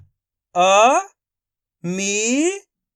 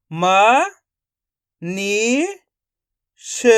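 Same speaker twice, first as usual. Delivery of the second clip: ordinary talk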